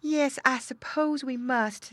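high, slow, sighing reply; resigned sigh. A narrator's reading. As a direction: give in reluctantly as mother pig. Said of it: "said Missus Pig with a sigh".

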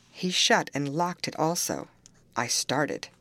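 usual speaking voice

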